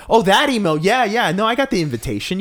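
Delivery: feigned recognition. Acted. Acting out bluffing HBO guest at event, feigned recognition. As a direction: bluff as if it just came back.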